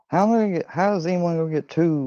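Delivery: high-pitched acted disbelief; retold incredulous question. Doubting his friend's promise of two girls, incredulous. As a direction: shoot the voice up as you re-enact doubting it.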